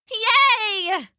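high-pitched drawn cheer; calling out. A narrator's reading. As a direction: cheer like an excited kid, way up high. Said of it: "Isabella called out".

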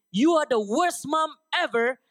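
retold tantrum accusation; angry kid's outburst. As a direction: voice a child lashing out at mom. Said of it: "In his anger".

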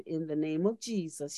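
low, soft and steady, very calm; calm command. Retold or imitated. Minister calmly rebuking the spirit, low and soft. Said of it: "looked at her very calmly and said".

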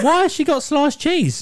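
high, slow disbelief; hearing his mum threw cheese, slow high disbelief; incredulous. Retold or imitated. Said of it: "I was like".

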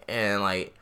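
usual speaking voice

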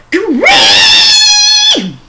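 very high, long loud shriek of "Gree!"; full-throat witch screech; witch scream. Pretend. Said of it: "She went".

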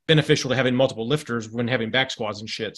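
ordinary talk, nothing marked

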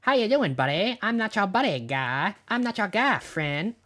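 cartoon Canadian accent, jumping high then dropping; South Park Canadian. Shown as a demonstration. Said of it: "that's South Park Canadian".

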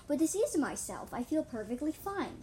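loud retort, easing off; yelling back. A narrator's reading. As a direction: snap back in a mild yell. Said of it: "the voice yelled back".

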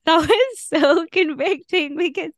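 laughing through the words, pitch jumping up; a real laugh breaking into the sentence. Real, not acted. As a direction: giggle out the words as they come. Laughing over the hymn line.

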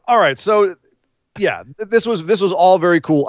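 ordinary talk

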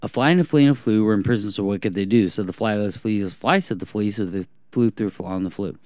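rattled off at top speed; fast recital. Shown as a demonstration. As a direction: race through the poem in one breath. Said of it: "I'm gonna say as fast as I can".

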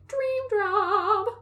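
high, delighted monster; gleeful monster voice. Retold or imitated. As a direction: chirp it like a happy monster. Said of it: "Campy was like".

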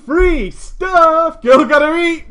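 loud, high hammed-up hype; big playful holler; mock cheering. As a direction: cheer it on with joking gusto. Mock cheering for free rent.

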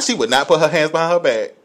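normal talking voice

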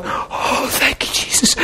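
hushed, mock weeping thanks; crying thanks. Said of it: "started shaking, crying, going".

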